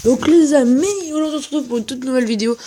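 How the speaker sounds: high, loud, stretched opening then a rush; big hammed-up greeting; hyped video intro. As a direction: launch it like an over-excited YouTuber.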